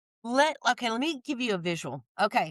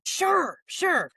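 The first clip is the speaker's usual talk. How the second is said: high-pitched, perky "sure, sure"; acted-out keen yes, pitched way up; eager agreement; 9 semitones higher than usual. Niece agreeing to aunt's hot dog bun errand, eager.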